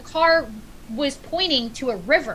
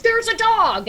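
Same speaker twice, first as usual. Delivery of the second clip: excited exclaim; 10 semitones higher, 6 dB louder, slower than usual. Retold or imitated. Firemen's excited discovery quoted.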